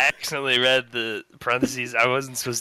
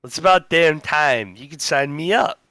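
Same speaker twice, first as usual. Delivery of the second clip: slow, deliberate newscaster read; news anchor read; 4 semitones higher, slower than usual. Acted. Reads a mock news story.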